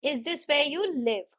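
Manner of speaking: Is this where you live? rising on live; slow model yes-no question lifting on live; rising tune. Shown as a demonstration. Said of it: "rising tone हो गई।".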